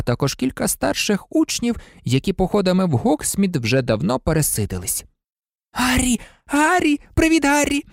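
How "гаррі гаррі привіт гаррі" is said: put-on higher, rising excited boyish calls; eager greeting. A narrator's reading. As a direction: call out to your idol, thrilled. Eager fan greets his hero.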